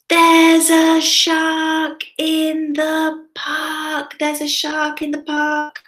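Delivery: high, drawn-out alarm call; storybook shout. Acted. Children help Timothy warn of a shark, storybook shout. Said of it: "he's about to shout".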